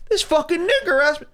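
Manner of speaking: high-pitched mocking quote of him; mimicking the man. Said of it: "he's talking to the woman cop".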